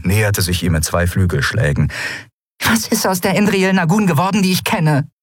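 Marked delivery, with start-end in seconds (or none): 2.6-5.1 high, plaintive complaint; lamenting. Acted. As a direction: wail it as a reproach. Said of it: "klagte sie".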